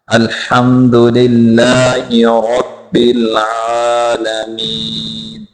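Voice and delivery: slow, drawn-out tajweed recitation; measured model recital for learners; Quranic recitation. Shown as a demonstration. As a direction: recite the verse slowly, holding the long vowels.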